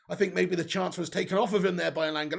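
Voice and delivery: usual speaking voice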